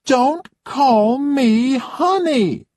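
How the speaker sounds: haughty high-pitched complaining lady; spoilt rich guest. Acted. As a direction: play the pampered diva who expects service. Roxanne snaps again.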